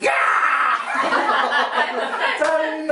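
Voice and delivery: a big mock yell, laughing through it; laughing retold shout of an angry father; father's roar. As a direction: bellow like the father cutting him off, high and loud. Father roars at arguing son.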